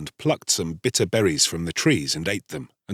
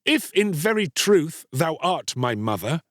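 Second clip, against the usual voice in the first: put-on higher-pitched bitter boy; hard and bitter; 7 semitones higher than usual. A narrator's reading. Boy answering the mother he rejects, hard and bitter. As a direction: speak hard, with restrained resentment. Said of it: "his voice was hard and bitter".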